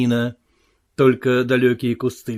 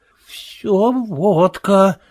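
ordinary delivery

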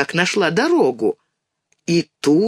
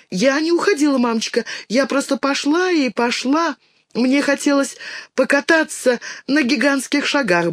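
put-on little girl voice; 9 semitones higher than usual. Child Dinka's voice.

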